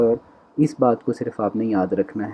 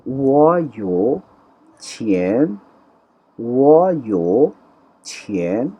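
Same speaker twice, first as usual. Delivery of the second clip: وہ یو چھین said slowly with a rising چھین; slow model Chinese; much slower than usual. Shown as a demonstration. Unhurried tone drill. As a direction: Chinese teacher drills the sentence for students, slowly. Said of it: "slow model Chinese sentence".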